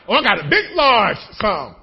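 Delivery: loud, hammed-up announcement; excited testimony. Believer testifying about money to the church, loud and excited. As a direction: proclaim it big and loud.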